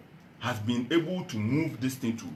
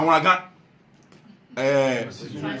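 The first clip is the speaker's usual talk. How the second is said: ordinary talk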